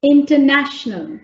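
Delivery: International, third syllable NA stressed, falling at the end. Shown as a demonstration. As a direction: punch the NA in International. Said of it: "so it's na".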